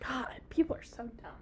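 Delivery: fast disgusted whisper; whispered aside. Real, not acted. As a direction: mutter it under the breath.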